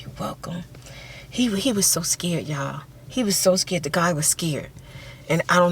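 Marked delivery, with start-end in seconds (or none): 0.0-0.6 soft whisper, barely voiced; whispered reply. Young rescuer whispering back to the girl. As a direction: hush it out.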